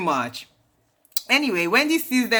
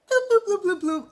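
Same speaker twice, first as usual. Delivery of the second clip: playful squeaky mock bloops, dropping a little; high-pitched bloops; 13 semitones higher than usual. Vlogger imitating Wendy snubbing Giselle, high playful bloops.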